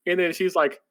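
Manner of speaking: ordinary talk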